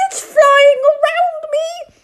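high, loud frightened cry; panicked shout. Acted. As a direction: shriek as the wasp circles you. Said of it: "shouted Captain Beaky".

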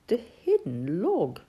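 ordinary delivery, nothing marked

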